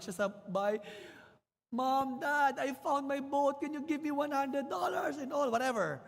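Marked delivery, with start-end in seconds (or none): 1.7-6.0 put-on pleading boy. Boy begging his parents.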